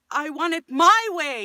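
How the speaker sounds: a hint of a whiny demanding kid; mock spoiled child wanting ice cream. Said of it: "It comes from".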